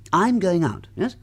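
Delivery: ordinary talk, nothing marked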